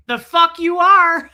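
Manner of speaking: loud, high, slow mock outburst through a laugh; mock shout. Aaron refusing Marilyn's pool holiday, loud laughing mock shout. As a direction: bark it out, laughing at the nerve. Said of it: "Aaron was like".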